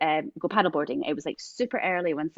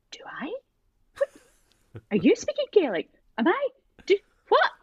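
high, bewildered questions; a lost ten-year-old, pitched up; mock panicked kid; confused child; 11 semitones higher, 4 dB louder than usual.

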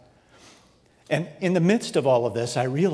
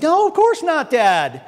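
much higher, louder mock teen denial; 12 semitones higher, 6 dB louder, slower than usual. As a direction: toss off a fake innocent no. Older daughter denying.

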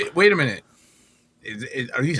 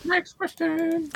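much higher, slow squeak; high squeaky voice; much slower than usual. Pretend. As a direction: squeak it out slowly. Mocking echo of next question.

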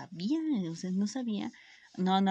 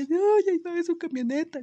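high, louder hammed-up sobbing panic; mock crying; 10 semitones higher, 4 dB louder than usual. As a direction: squeal it like you're faking tears over the truck. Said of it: "yo llorando".

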